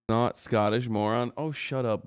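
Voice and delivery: ordinary talk, nothing marked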